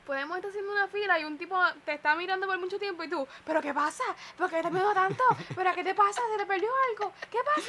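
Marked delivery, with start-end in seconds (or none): 3.5-7.7 mock confrontation. Friend voices him picking a quarrel.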